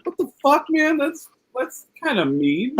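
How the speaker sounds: high-pitched retold objection; hurt protest. Comic objecting to Gene's mean joke, high hurt protest.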